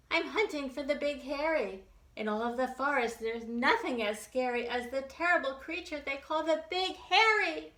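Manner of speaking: high small bunny voice; little bunny. Acted. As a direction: play a tiny eager bunny.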